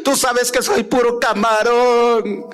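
slow, hammed-up crying plea; mock wailing plea. Preacher mocking a worshipper wailing for help. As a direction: overdo the groveling lament. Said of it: "Y sigue gritando".

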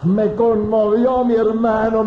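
high, loud, slow impassioned preaching; high-pitched, loud, slow fervent testimony; preaching at full force. Acted. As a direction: lift the congregation with fervour.

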